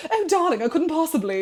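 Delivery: high, mock super-posh English accent. Imitating posh Windsor customers, super-posh voice. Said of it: "because Windsor is super posh".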